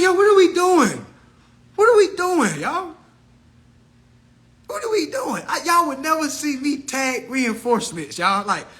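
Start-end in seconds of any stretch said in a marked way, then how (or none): 0.0-5.4 high, loud, incredulous; exasperated. Retold or imitated. Scolding followers over what they're doing, exasperated. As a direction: throw up your hands at them.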